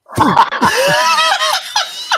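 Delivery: a high, loud burst of real laughter; loud laughing shriek. Real, not acted. Telling mates about the blast, high loud laughing shriek.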